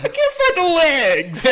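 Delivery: high, slow laughing fake sob; mock crying. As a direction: wail it through laughter, hammed-up. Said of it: "he starts crying".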